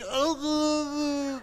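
high, drawn-out garbled cry; choked mock groan. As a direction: groan as if your tongue curled up. Tongue curls up mid-gossip.

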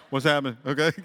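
ordinary talk

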